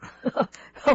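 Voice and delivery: short breathy laugh; amused chuckle. Real, not acted. Radio host amused by an odd article title, chuckling. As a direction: let out a brief chuckle.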